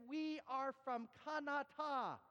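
slow, high Thai-inflected English; Canada drawn out as Kanata, Thai-style; picked-up Thai accent. Retold or imitated. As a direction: sing it out slowly with Thai inflections. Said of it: "start to speak my own language the way they did".

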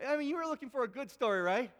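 ordinary talk

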